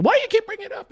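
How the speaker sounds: squeaky mock complaint; high mock girlfriend. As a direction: pitch right up to play her. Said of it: "And she".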